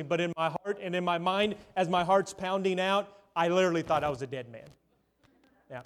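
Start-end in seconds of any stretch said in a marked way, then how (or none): none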